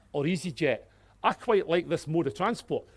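ordinary delivery

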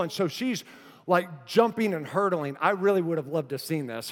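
usual speaking voice

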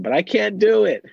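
high, loud mock whine; childlike protest. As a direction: protest like a frustrated little kid. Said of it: "we might be saying back to him".

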